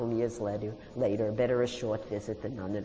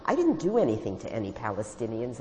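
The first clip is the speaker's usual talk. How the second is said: plain reading voice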